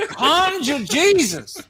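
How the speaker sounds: mock preacher calling on Jesus; televangelist boom. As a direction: summon it like a tent revival preacher. Mocking televangelist Kenneth Copeland.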